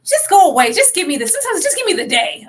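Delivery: high, pleading exasperation; mock exasperated wife. Co-host imitating wife brushing husband off, exasperated. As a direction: beg for space, hammed up and high. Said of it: "When she said, like".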